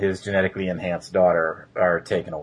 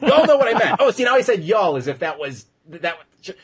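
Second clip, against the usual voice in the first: high, loud, fast laughing protest; 8 semitones higher, 5 dB louder, faster than usual. Real, not acted. Host explains his accent to co-host, laughing. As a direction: crack up while defending yourself. Cracks up over own accent.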